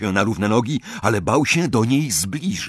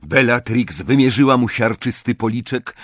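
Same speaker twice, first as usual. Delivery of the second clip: usual speaking voice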